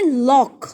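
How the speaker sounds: लॉक said as lock, ending in a k sound; model pronunciation. Shown as a demonstration. Teacher showing pupils how lough is read, clearly. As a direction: say लॉक, rhyming with rock. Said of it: "इसको पढ़ते हैं".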